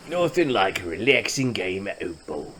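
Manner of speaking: a light mock Cockney accent, relaxed; a touch of put-on Cockney British. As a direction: voice the colonist with a hint of Cockney. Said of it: "I don't know if he's Cockney British".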